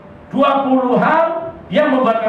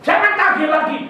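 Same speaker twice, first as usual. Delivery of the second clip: pressing pastor; 10 semitones higher than usual. Retold or imitated.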